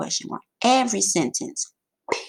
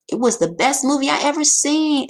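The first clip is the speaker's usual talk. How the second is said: higher and louder, full of excitement; excited exclamation; 7 semitones higher, 6 dB louder than usual. Shown as a demonstration. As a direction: say it like you loved the movie. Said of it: "Exclamation point".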